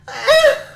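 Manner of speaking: high, loud mock yelp. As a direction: let out a hammed-up squeal. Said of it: "and she goes".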